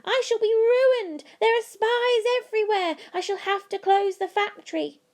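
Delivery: shouting. A narrator's reading. Willy Wonka ruined by spies, frantic shouting. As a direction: wail in despair, tearing your beard. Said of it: "tore his beard and shouted".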